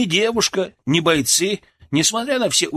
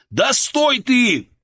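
high, slow urgent shout; 10 semitones higher, 3 dB louder, much slower than usual. A narrator's reading. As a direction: yell to stop her. Shouting at fleeing girl.

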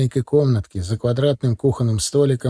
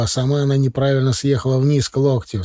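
plain reading voice

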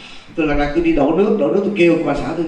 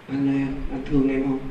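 teeth clenched; 2 semitones lower, 6 dB quieter than usual. Retold or imitated. Possessed wife asking husband if he loves her, softly through clenched teeth. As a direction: imitate the possessed wife, quietly, teeth together. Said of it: "mà nói cắn cái răng lại".

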